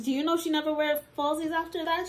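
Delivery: normal talking voice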